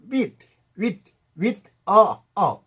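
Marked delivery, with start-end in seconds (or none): 0.1-1.6 "with" said with teeth close together; "with" with the teeth pressed near, a clear w. Shown as a demonstration. Teacher drilling "with" with the teeth close together. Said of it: "이가 바짝 붙어".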